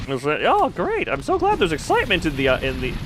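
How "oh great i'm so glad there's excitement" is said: sarcastic great. Real, not acted. Streamer greeting more danger with a high sarcastic cheer. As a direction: fake delight at more trouble. Sarcastic reaction to new enemies.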